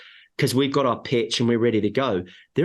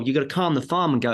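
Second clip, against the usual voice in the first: ordinary talk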